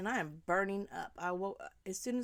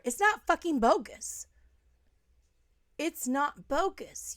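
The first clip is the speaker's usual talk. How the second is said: louder and higher, furious; angry outburst; 5 semitones higher, 7 dB louder than usual. Real, not acted. Rejecting the mother's bogus claim, furious and loud. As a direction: spit out the denial.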